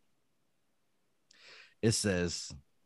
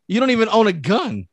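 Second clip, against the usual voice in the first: amused read; 9 semitones higher, 5 dB louder than usual. Retold or imitated. Reading a reply, laughing.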